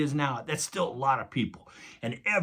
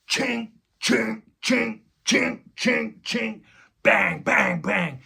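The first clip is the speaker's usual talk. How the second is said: hammering sounds; 8 semitones higher, 4 dB louder, slower than usual. Retold or imitated. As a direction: tap out the nailing sounds. Imitating nails hammered into cross.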